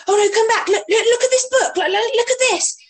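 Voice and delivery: high, loud, over-eager distraction; mock-urgent mum luring a child away from upset; imitating frantic distracting grandma. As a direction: gush loudly up high, tumbling over repeated words. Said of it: "my mum was like".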